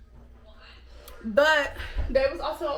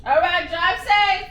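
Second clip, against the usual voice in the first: calling out; 4 semitones higher, 7 dB louder, slower than usual. Real, not acted. Calling to husband on phone.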